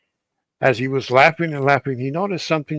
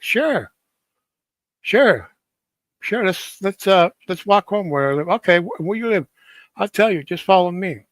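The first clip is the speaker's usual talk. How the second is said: put-on a touch higher, the girl's inviting reply; lightly voiced young woman; 5 semitones higher than usual. Strange girl inviting the young man home, lightly higher voice. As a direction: lift the voice a little for the mysterious girl.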